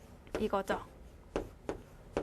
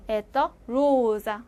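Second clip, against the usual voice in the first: 에떠 로자 said very slowly and evenly; slow model reading; much slower than usual. Shown as a demonstration. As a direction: stretch out 에떠 로자 for the class to copy. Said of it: "제가 두 번씩 읽을 테니까".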